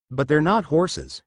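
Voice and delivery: ordinary delivery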